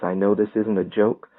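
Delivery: ordinary delivery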